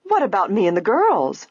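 high, indignant demand; protest, aghast at being left out; crying out. A narrator's reading. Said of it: "cried Missus Topham".

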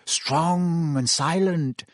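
slow, dreamy, swooning. A narrator's reading. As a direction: savour each word about a crush. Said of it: "they started to giggle again".

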